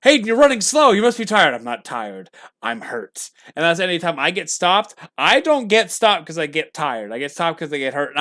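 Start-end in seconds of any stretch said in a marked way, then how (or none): 0.0-1.5 higher, louder coach calling out; coach's call. Retold or imitated. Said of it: "my old football times". 1.5-3.3 much softer, lower, hurt reply; a worn-out player answering his coach softly; quiet reply. Retold or imitated. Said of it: "my old football times".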